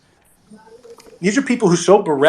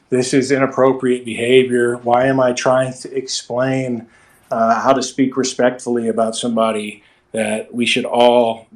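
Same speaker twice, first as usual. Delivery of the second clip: flat monotone; 4 semitones lower than usual. Shown as a demonstration. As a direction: drone it out with no feeling. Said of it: "boring and confusing if I was just like".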